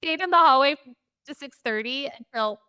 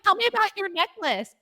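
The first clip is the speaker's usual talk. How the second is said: higher, chirpy, overly friendly small talk; chirpy husband imitation; 10 semitones higher, 5 dB louder than usual. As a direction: mimic someone cheerfully chatting up a stranger. Mocking husband's chattiness.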